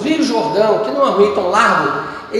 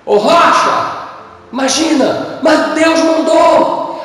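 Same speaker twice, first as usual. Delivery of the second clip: louder, higher mocking call to a rock; mock incredulous; 5 semitones higher, 3 dB louder, much slower than usual. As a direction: ham up talking to a stone. Zomba de falar à rocha. (chamando a rocha em tom de deboche, bem mais alto e agudo)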